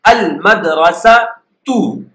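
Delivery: Al madrasa tu said slowly with the final tu stretched clearly; case ending demo. Shown as a demonstration. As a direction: spell out the ending tu on Al madrasa tu, slow and deliberate. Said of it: "There will be coming dhamma". (slow teacher demo of the dhamma ending in Al madrasa tu)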